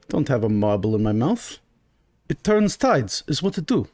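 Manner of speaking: thick-tongued "turns tides", sliding in pitch; marble-mouthed. Acted. Shopkeeper denying marbles in mouth, thickly mouthed "turns tides". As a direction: garble "It turns tides" while insisting on it. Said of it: "Get these marbles out of your mouth".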